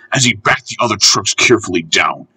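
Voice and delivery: ordinary delivery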